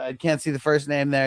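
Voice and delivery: normal talking voice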